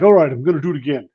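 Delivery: fast, light mock Boston accent of a buddy; imitating a Boston accent. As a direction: rattle it off in a jokey Boston accent. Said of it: "That's how he talks. He's from Boston".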